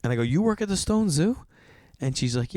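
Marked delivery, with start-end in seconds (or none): 0.2-1.5 surprised question. Retold or imitated. Incredulous quote of himself.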